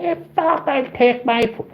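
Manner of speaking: high, slow mock outrage; hammed-up girl's furious accusation; imitating furious girl. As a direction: squeal it up high like the angry girl.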